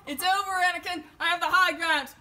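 loud, high hammed-up Star Wars shout; Obi-Wan duel line. Host dubbing sister's fight with a wasp, in a loud hammed-up film shout. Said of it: "video of my sister doing battle".

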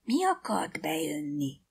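whispered, hushed questioning. A narrator's reading. Keddi asks Nancy what tried to enter, whispering. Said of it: "suttogta Keddi".

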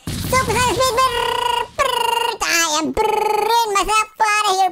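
squeaky hyped hero; high cartoon voice. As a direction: shriek it like an anime hero.

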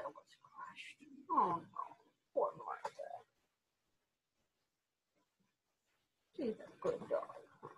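soft whispered sympathy; hushed pity for the dog. Real, not acted. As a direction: whisper it tenderly and slowly. Sad aside about Martha.

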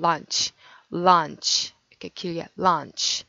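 lunch with a long drawn vowel, slowly. Shown as a demonstration. Teacher modelling a long vowel in lunch for students. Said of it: "요거는 약간 길게 좀 더 발음하고".

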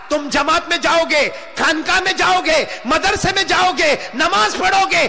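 high sermon delivery, climbing to a shout; fiery preaching. Acted. Preacher warning youths who wrong their mothers, fiery preaching. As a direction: build the warning to full pitch.

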